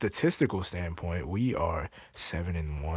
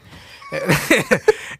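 real high, loud laugh; 7 dB louder than usual. Real, not acted. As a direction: burst out laughing. Laughing at own bit.

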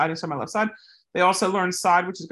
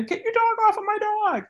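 high yelled demand, pitched way up; yelling. Retold or imitated. Woman yelling at off-leash dog owner, high yell. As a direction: shout it to get help. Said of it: "she starts yelling".

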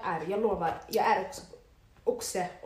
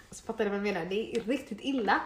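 ordinary delivery

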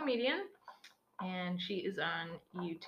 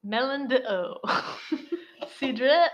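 mock French accent; 4 semitones higher, 11 dB louder, slower than usual. Reading French side of can.

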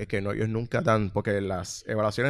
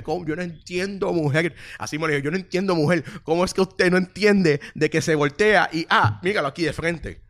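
raised, higher, exasperated yelling, slowing on the last question; yelling at customer; 7 semitones higher, 4 dB louder than usual. Retold or imitated. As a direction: scold her in disbelief, voice up. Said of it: "yo gritándole como que".

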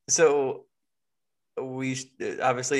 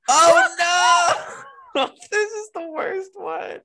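laughing; 8 dB louder, slower than usual. Real, not acted. Laughing at another shirt.

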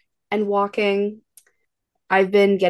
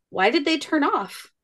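put-on light child voice; 6 semitones higher than usual. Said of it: "Reagan goes".

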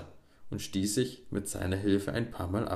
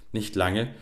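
ordinary delivery, nothing marked

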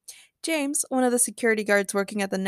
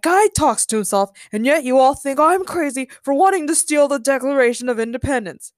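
raised, loud protest; indignant shout; 4 semitones higher, 5 dB louder than usual. A narrator's reading. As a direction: protest loudly at the unfairness. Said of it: "Ben Gates shouted".

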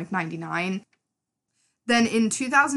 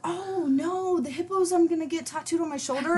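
high-pitched excited gush; 10 semitones higher than usual. Real, not acted. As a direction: squeal it out, very high. Gushing over the hippo tattoo idea.

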